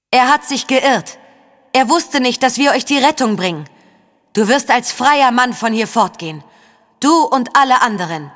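high, emphatic calling voice; beschwörendes Rufen. A narrator's reading. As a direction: call up a promise of freedom with conviction. Saga verspricht rufend Rettung. (eindringlich hinaufrufen)

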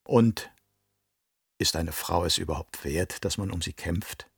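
low, soft, insinuating question; quiet seductive goading; suggestive. A narrator's reading. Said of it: "wie sie diese Worte aussprach".

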